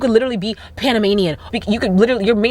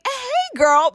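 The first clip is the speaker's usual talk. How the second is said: put-on much higher sing-song hey girl; fake friendly greeting. Woman pretending to know a stranger, much higher sing-song greeting. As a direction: call out like an old friend. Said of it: "be like".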